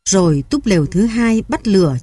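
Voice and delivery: ordinary delivery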